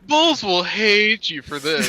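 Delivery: loud, slow announcer voice; clickbait video narrator. Pretend. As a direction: hype it like a clickbait ad. Parodying clickbait video titles.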